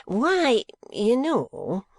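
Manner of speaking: nervous Squeers. A narrator's reading. Squeers fidgeting, evading.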